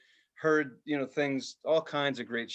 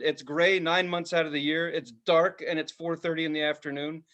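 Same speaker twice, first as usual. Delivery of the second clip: ordinary delivery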